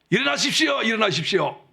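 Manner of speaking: high calling shout to wake someone. Shown as a demonstration. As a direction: call out sharply, high. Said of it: "이렇게 저처럼 소리 지르면".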